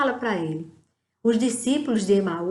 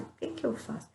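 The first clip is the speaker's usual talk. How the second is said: whisper; 13 dB quieter, faster than usual. Pretend. Inner prayer to God.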